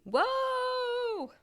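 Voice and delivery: high, loud yelp of surprise; sudden high shout of alarm; startled whoa. Acted. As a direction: cry out as the floor vanishes. Housewife pulled into the wall.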